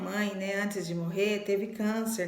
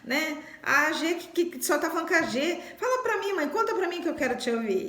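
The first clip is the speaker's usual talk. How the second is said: much higher, wheedling teasing voice; imitating older sister; 7 semitones higher than usual. Older sister teasing the tired daughter by coaxing mom, high wheedling imitation. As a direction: mimic a sister coaxing mom to tell her stories. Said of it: "ela falava pra mim assim".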